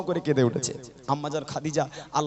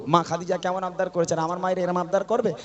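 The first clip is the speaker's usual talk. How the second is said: ordinary talk, nothing marked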